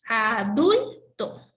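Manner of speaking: Adulto slow and loud, leaning on dul; stressed syllable. Shown as a demonstration. Teacher slowly stressing a word for students. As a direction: stretch out Adulto, hitting dul. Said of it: "aquí está la mayor fuerza de voz".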